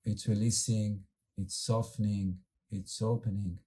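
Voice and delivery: calm, unhurried meditation guide with long pauses; guided meditation. Acted. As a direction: gently lead the group inward, letting words settle.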